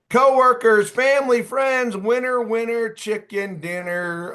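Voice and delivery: high, loud game-show cheer; excited celebration. Real, not acted. Coach celebrating correct answers in chat, excited. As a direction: call out winning answers with a burst of energy.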